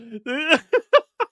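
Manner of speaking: high-pitched helpless laugh; real laughing fit. Real, not acted.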